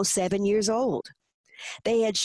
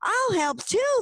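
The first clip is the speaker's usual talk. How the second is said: put-on very high-pitched little-girl cheer; excited shout; 13 semitones higher, slower than usual. A narrator's reading. Mindy getting her own desk and chair, excited high shout. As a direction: squeal it high, leaping up with delight. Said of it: "Mindy shouted, leaping to her feet".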